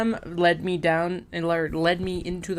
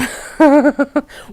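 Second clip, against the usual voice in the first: a sudden loud burst of laughter; 11 semitones higher, 12 dB louder than usual. Real, not acted. Picturing the fed-up wife, hearty real laugh.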